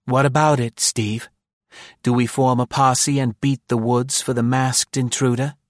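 mocking woman voice. A narrator's reading. Maud mocking.

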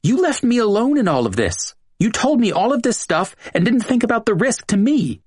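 high voiced Julia, pushing back; defiant woman. A narrator's reading. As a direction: throw the blame back at him, pitched high. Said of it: "Julia became defiant".

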